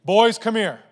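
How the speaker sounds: booming dad summons. Retold or imitated. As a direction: call the boys in dad mode. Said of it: "in my dad voice".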